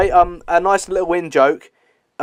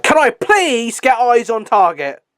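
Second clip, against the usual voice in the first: higher, drawn-out plea of an intense officer; intense military man; 7 semitones higher, slower than usual. Retold or imitated. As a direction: imitate an over-intense military man, stretching the words. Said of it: "he's so intense".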